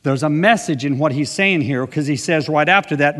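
ordinary talk

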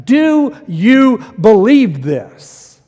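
very slow, loud, each word hammered; loud challenge. Shown as a demonstration. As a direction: throw the question at the crowd. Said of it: "That's the challenge".

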